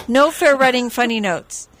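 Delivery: usual speaking voice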